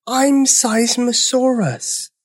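very high, slow little dinosaur voice; enormous dinosaur with a tiny squeak of a voice. A narrator's reading. Huge dinosaur introducing himself, tiny voice. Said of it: "in a voice so tiny".

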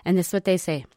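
ordinary talk, nothing marked

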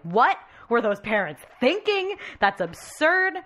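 high, loud mock indignation; imitated outraged disbelief. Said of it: "we were all like".

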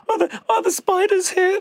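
a jittery visitor asks locals about spiders, squeaky and scared; anxious squeak. Retold or imitated. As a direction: squeak it out like a frightened tourist. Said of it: "I've been like".

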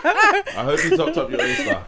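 high, slow laughing quip. Real, not acted. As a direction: squeak it out through laughter. Laughing joke, high pitch.

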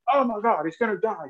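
high-pitched imitation of a panicking friend; freaking out. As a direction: mimic him freaking out, voice jumping up. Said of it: "Chuck was freaking out".

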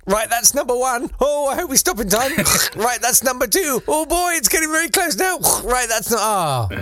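very high, straining, mock-frantic voice; hammed-up panic. Comedian as engineer racing to brake each carriage, hammed-up panic. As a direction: play rising alarm between heaves on each lever.

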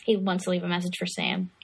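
usual speaking voice

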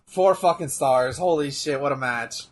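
ordinary delivery

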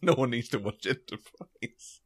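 slow, barely keeping a straight face; fighting back laughter. Real, not acted. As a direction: say it while trying not to laugh. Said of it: "couldn't even say that without laughing".

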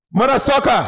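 high, loud comic cry; catchphrase shout. Retold or imitated. Comic trying out his new catchphrase backstage, high excited shout. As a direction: belt it out as a stage catchphrase. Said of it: "I'm like".

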